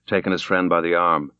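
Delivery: ordinary delivery, nothing marked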